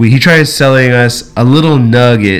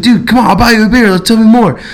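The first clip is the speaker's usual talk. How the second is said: high, fast feigned eagerness; playing real stupid; 10 semitones higher, faster than usual. Storyteller plays dumb, coaxing the dealer over a beer, high and eager. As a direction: coax him as a wide-eyed fool, pitched up. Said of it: "just playing real stupid".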